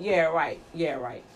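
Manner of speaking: low, soft, disbelieving scoff; dismissive. Acted. Preacher answering a manipulative flatterer, dismissive. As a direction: brush it off under your voice, unconvinced.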